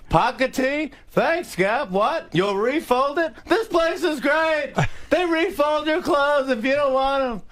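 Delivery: high-pitched yelled stand-up delivery; shrieking incredulous comedian, words stretched; Jerry Seinfeld. Acted. Seinfeld yelling back about The Gap, high imitation. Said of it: "So we're just yelling back and forth".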